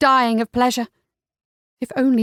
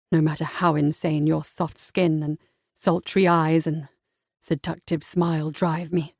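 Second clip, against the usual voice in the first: low seductive male voice; 6 semitones lower than usual. A narrator's reading. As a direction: murmur a tender compliment. Voices the hero.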